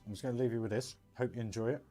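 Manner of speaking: ordinary talk, nothing marked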